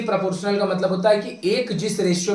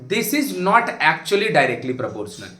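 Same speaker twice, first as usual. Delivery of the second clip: slow emphatic correction; much slower than usual. Real, not acted. Teacher correcting a common misconception.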